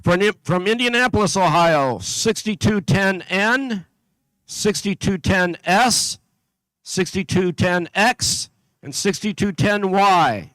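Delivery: steady, carrying PA announcer voice; announcer read. Acted. Announcer calling out teams to the competition crowd, steady and carrying. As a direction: call out each town and team number to the hall.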